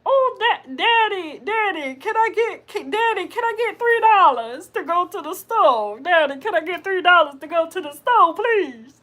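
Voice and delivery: high, pleading cartoon kid; Cleveland Brown Junior. Child asks Daddy for three dollars, high pleading impression. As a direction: beg Daddy in a squeaky cartoon voice. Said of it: "that is exactly how he sound".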